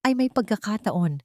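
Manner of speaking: plain reading voice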